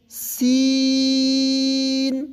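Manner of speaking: سین with a thin s, vowel held very long; long chanted سین; thin, drawn-out letter. Shown as a demonstration. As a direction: stretch the ee of سین on one even note. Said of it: "پتلا باریک پڑھتے ہیں".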